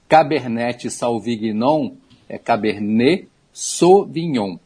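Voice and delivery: Cabernet Sauvignon Brazilian-style, then slowly in French; slow pronunciation demo. Shown as a demonstration. Expert teaches listeners a grape name, slow demonstration. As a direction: break Cabernet Sauvignon into careful French "ca-ber-nê sô-vi-nhon". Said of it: "Não é Sauvignon, não, é Sauvignon".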